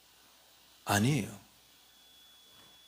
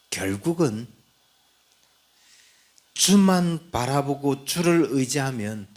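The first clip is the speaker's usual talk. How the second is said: ordinary delivery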